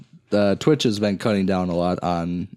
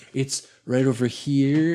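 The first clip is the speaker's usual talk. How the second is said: drawn-out, slightly high coy streamer voice; imitated girl streamer; 4 semitones higher, slower than usual. Co-host imitating streamer getting up in short shorts, drawn-out put-on voice. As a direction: stretch it out like a streamer showing off. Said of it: "She's like".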